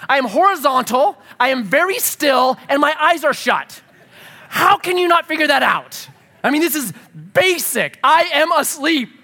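exasperated rant. Retold or imitated. Exasperated dad rant about kids.

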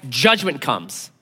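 normal talking voice

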